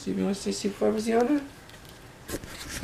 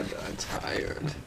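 hushed, drawn-out imitation of tiredness; quoting a tired child, very softly; imitating tired child; 14 dB quieter, slower than usual. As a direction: murmur it like a sleepy little one. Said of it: "She told me to say".

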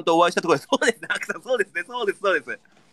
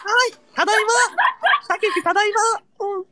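much higher, playful falsetto; high squeaky reply; faster than usual. Pretend. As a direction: squeak back like a cartoon master. Answers the maid greeting.

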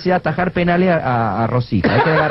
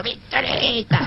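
softer, higher mock wail; a small, high imitation sob; mock crying; 5 semitones higher, 6 dB quieter, much slower than usual. Dwarfs crying for the dead Pinturita, mock crying. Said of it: "Estos enanos llorando".

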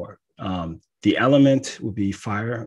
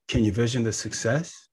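normal talking voice

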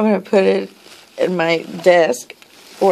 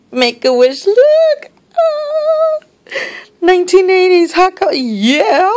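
high squeal of delight; very high, excited gushing; thrilled; 12 semitones higher, 4 dB louder than usual. Real, not acted. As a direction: squeal excitedly over a new find. Thrilled by the gift.

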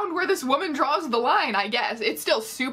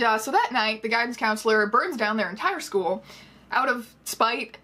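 usual speaking voice

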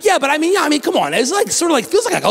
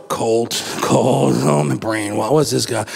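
much lower, dazed musing; stunned relative; 10 semitones lower than usual. Retold or imitated. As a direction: think it aloud, baffled. Relatives' inner reaction.

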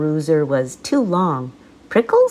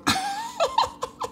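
very high shrieking laugh; Fluffy laughing out loud at the name Hippo; burst of laughter; 5 dB quieter than usual. Acted. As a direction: let the laugh explode. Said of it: "laughed out loud".

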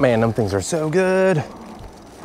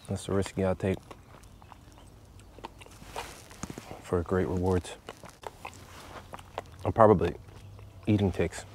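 quiet mumble; 7 semitones lower, 15 dB quieter than usual. Real, not acted. Very soft low mumble.

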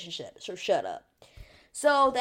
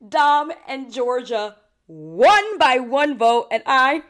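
higher and much louder, slowing down on the winners; excited outburst; 5 semitones higher, 11 dB louder, slower than usual. Real, not acted. Telling viewers who won, a loud excited outburst. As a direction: burst out with the news.

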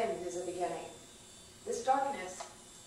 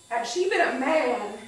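loud, breaking-open demand to the world; anguished cry; 4 semitones higher, 8 dB louder than usual. Acted. Grieving parent demanding answers from the world, anguished cry. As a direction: hurl the question out in pain. Third take, bursting out.

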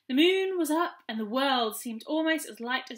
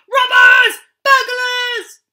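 loud, high cry of alarm; alarmed shout; 10 semitones higher, 15 dB louder, much slower than usual. A narrator's reading. Gnome raising the alarm from his window, shouting. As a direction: yell for help out the window.

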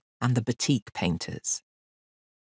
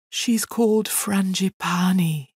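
slow, higher lovelorn sigh; mournful elephant; 6 semitones higher, slower than usual. A narrator's reading. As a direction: sigh the beloved's name slowly. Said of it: "said Hamlet mournfully".

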